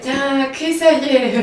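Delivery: slow, drawn-out morning-after groan; hungover dragging. Retold or imitated. Gaby phoning friend the day after, hungover and slow. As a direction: drag the words out as if hungover.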